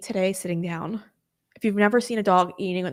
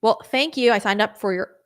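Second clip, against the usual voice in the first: usual speaking voice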